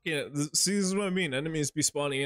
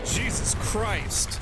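drawn-out, higher Jesus Christ; stunned exclamation; 7 semitones higher, 4 dB louder, much slower than usual. Real, not acted. Player reacting to a deafening explosion, slow awed exclamation. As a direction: stretch out Jesus Christ slowly, high and awed. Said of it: "It's loud as shit".

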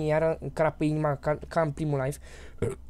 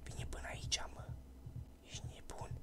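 barely audible whisper; hushed whisper; 17 dB quieter than usual. Real, not acted. Player hiding as the monster comes near, whispering.

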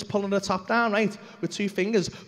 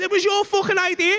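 high, raised accusing outburst; acted high-pitched indignation; indignant retort; 9 semitones higher, 4 dB louder than usual. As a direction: throw the blame back, pitched right up. Retells angry retort to mate.

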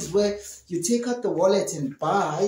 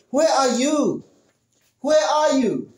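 calling louder across the house; mother calling out; 6 semitones higher, 4 dB louder than usual. Retold or imitated. Mother searching the house calls for her child, raised voice. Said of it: "they say".

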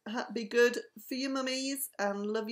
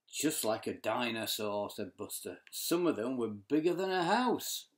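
low dinosaur voice; 10 semitones lower than usual. Acted. Buster dinosaur talk, low.

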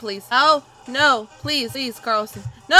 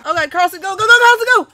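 frantic urging; 7 semitones higher, 8 dB louder, much faster than usual. Real, not acted. Urging character across bridge.

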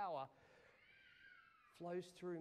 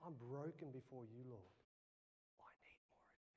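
lower, much softer, near whisper; whispered prayer; 7 semitones lower, 15 dB quieter than usual. Retold or imitated. Pastor confessing brokenness to the Lord in a whisper. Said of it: "And I go".

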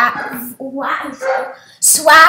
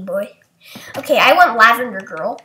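usual speaking voice

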